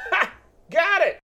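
high, pleased exclamation; triumphant. Real, not acted. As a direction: celebrate finding it. Found item triumph.

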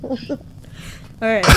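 ordinary delivery

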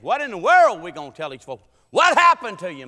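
loud, exasperated demand; comic outraged outburst; mock outrage. Acted outrage at preacher.

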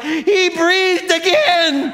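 drawn-out, very high yell; shocked shout. Retold or imitated. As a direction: yell the unbelievable news, stretched out. Both shout the shocking news.

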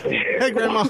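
high-pitched mock old grandma. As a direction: greet like a squeaky old granny. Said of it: "they sound like grandma there".

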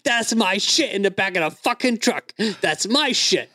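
hammed-up outrage over his package; raging dad. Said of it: "the way my dad was explaining it".

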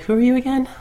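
mock clueless asking; puzzled Jyn. Jyn voiced not knowing Krennic.

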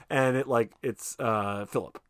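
normal talking voice

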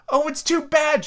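high, exasperated outcry; crying out in frustration. A narrator's reading. Alice fed up with the house, high exasperated cry. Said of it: "she cried".

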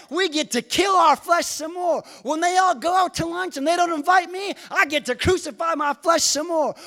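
loud, high, driving sermon climax; full-force preaching. Acted. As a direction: preach it hard, rising to the crowd.

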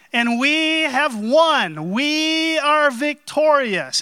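loud proclaiming herald, slow at the end; town crier. Retold or imitated. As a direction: announce victory to the crowd like a herald. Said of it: "he would say".

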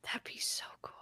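breathy whisper, no voice. Real, not acted. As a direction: whisper it in awe. Whispered aside.

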